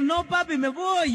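high, lightly voiced daughter, a hint of tears; tearful daughter. Preacher voices a bride telling her father she's leaving, high and lightly tearful. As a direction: pitch up as the bride, mock weepy.

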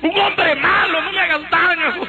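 furious father shouting his son down; father's angry shout. Retold or imitated. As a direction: yell it down at your son like an outraged father.

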